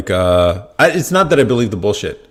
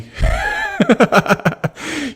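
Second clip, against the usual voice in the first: shouting laugh. Real, not acted. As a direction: whoop with laughter. Laughs at Trump quote.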